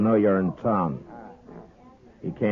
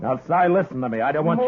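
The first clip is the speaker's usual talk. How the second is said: urgent raised warning; 8 semitones higher, 5 dB louder than usual. Acted. Marshal warns gunman to back off.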